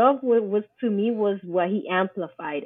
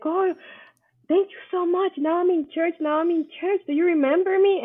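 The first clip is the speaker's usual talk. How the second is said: excited, much higher voice of a thankful churchgoer; grateful stranger; 9 semitones higher than usual. Retold or imitated. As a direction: gush with thanks in a high voice. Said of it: "they would be like".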